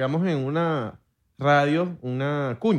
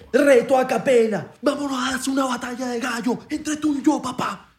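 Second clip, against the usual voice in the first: hyped challenge; 9 semitones higher than usual. Acted. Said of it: "yo soy un alto freestyler".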